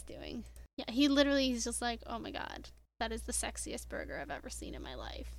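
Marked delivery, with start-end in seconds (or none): 2.1-5.3 soft, near-whispered mock awe; hushed awe. Voicing Cass watching a burger being eaten, hushed. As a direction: breathe it out quietly, as if entranced.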